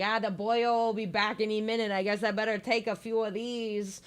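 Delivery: put-on lightly done Irish accent for the skeleton; bad Irish-ish accent. Voicing Skelly in a half-hearted Irish accent. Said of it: "That's probably a terrible accent".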